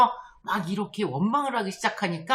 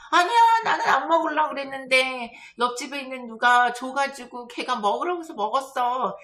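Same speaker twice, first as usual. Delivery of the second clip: put-on higher, louder childish protest; whining child; 3 semitones higher, 4 dB louder than usual. Child blaming the neighbour's kid for candy, whining protest. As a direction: whine an excuse like a child. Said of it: "그럼 아이는 뭐라 그러죠?".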